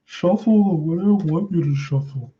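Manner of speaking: a playful silly voice scolding the cards; odd put-on voice. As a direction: put on a funny voice. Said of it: "Why am I even speaking like this now?".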